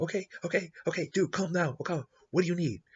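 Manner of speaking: high, anxious minion voice pleading calm; nervous placating. Retold or imitated. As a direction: talk him down, a little nervously.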